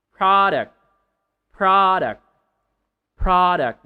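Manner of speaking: Product loud, stressed on PRO. Shown as a demonstration. Teacher drilling the stress of product for learners, loudly. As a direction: punch the first syllable of Product. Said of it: "Not product".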